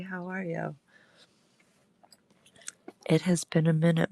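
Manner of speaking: ordinary delivery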